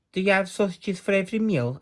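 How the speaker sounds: high, fast curious question; quick, high, boyish ask; eager Jack. Acted. Jack curious about the giant's meals, high and fast. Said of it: "asked Jack".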